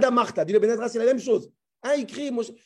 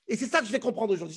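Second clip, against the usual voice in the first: ordinary delivery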